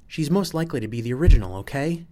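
loud, high, fast insistence; agitated. A narrator's reading. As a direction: snap back defensively, voice jumping up. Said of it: "Sol gets loud when he's agitated".

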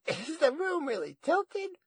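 high, loud granny joke; chuckle, then ask in a very high voice; dazed granny amused at her own joke; chuckling grandma. A narrator's reading. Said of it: "She chuckled as if she'd made a joke".

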